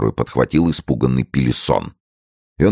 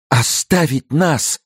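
very high, drawn-out wail of protest; grief-stricken outcry; 13 semitones higher, slower than usual. A narrator's reading. Both women fearing to be left, crying out. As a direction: cry out slowly, beside yourself with grief. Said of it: "разом вскричали обе женщины".

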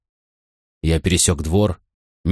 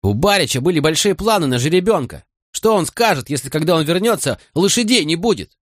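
high-pitched, fretful protest; agitated higher character voice; worried stable hand; 8 semitones higher than usual. A narrator's reading.

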